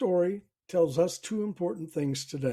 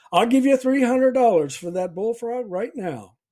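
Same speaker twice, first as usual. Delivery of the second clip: higher, louder excited call; eager offer; 6 semitones higher, 7 dB louder than usual. Acted. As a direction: blurt the offer out loud to grab the deal. Eager buyer bursts in with offer.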